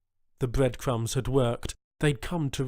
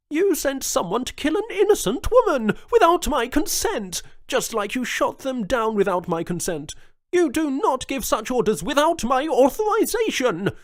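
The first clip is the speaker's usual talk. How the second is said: much higher and louder, raging; furious governor; 13 semitones higher, 7 dB louder than usual. A narrator's reading. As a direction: let the fury burst out. Jones furious at cousin.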